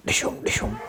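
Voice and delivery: soft, unvoiced movie punch noises; imitated punch sounds. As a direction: mimic film fight punches under the breath. Imitates film fight punches.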